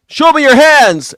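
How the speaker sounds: high barked order, loud; mock cop shout. Streamer mimicking the arresting trooper, high barked shout. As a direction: yell it like a cop at a suspect.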